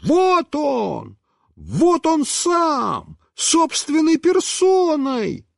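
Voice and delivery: put-on high, slow triumphant boy's yell; a boy shouting triumphantly, high and drawn out; excited shout. A narrator's reading. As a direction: crow it out like you caught him. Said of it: "да как закричит".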